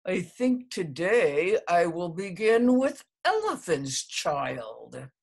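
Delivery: loud, gritted-teeth crocodile; between his teeth. A narrator's reading. The crocodile grips the child's nose, through clenched teeth. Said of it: "said it between his teeth".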